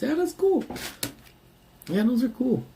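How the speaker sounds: high squeaky excitement; high squeak. Real, not acted.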